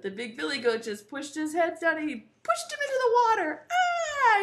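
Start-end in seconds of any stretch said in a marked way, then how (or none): 3.7-4.4 high, loud falling-troll yell; mock scream. Troll pushed into the water, mock scream. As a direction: cry out high as you fall.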